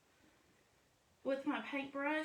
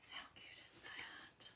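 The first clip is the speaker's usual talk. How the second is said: soft delighted whisper; 16 dB quieter, slower than usual. Real, not acted. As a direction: breathe it out in admiration. Admiring her finished craft.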